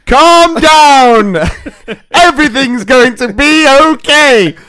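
high, loud mock shout in English. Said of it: "Fully expecting full kind of Portuguese. She was like".

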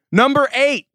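loud, high countdown announcement; game-show style list announcement; announcing. Acted. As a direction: call out the next item like a host. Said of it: "host announcing list item".

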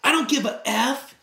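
mock angry shout; retold snap. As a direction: snap it back like a defensive guy. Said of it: "he turned around and snapped".

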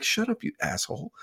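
high-pitched retort imitating his wife; wife scolding her husband over their daughter, mock snap. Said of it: "My wife's like".

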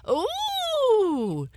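very high startled hare squeal. Acted. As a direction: squeal in sudden fright. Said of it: "squealed the hare".

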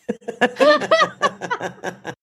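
real high breathy giggle. Real, not acted. Host cracks up at the line, high giggle. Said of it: "British understatement".

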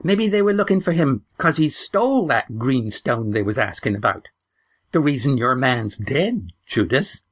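put-on high, indignant old woman; shrill indignation. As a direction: jab the blame at her, high and indignant. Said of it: "now grew shrill with indignation".

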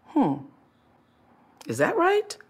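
"right" jumps up high in doubt; questioning hmm. Retold or imitated. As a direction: wonder aloud, rising. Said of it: "a whisper usually in your life feels like".

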